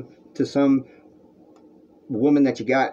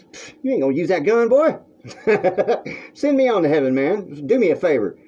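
a retold taunt, pitched up and cocky; cocky dare; 7 semitones higher than usual. Telling Matt he won't shoot, mock-bold dare. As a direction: taunt the kid with the gun, unafraid. Daring Matt who waved a gun.

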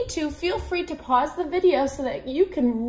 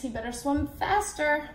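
ordinary delivery, nothing marked

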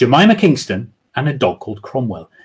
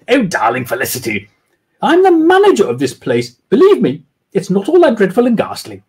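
lightly voiced Jemima; woman's voice; 8 semitones higher than usual. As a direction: set her straight politely. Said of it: "doing women's voices".

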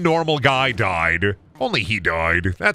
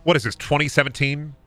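usual speaking voice

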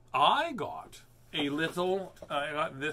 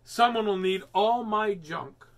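slow, firm and louder; firm declaration; 6 semitones higher, 6 dB louder than usual. A narrator's reading. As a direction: declare it with resolve. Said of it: "she declared firmly".

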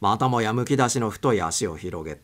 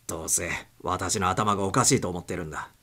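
plain reading voice

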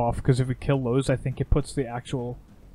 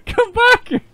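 laughing shout; 10 dB louder than usual. Real, not acted. Yelling again, laughing.

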